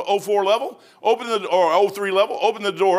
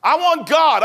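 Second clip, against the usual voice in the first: loud, higher cry of "I want God"; fervent declaration; 7 semitones higher, 8 dB louder, slower than usual. Acted. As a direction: proclaim "I want God" with everything.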